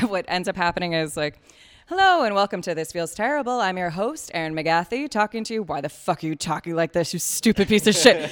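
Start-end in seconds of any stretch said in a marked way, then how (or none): 1.9-5.6 overdone host greeting, higher and louder; mock a podcast host winging the intro; improvised podcast intro. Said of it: "if I just kinda improvise". 5.6-8.3 loud, hammed-up outburst at yourself; mock self-berating. Mock self-berating over a botched intro.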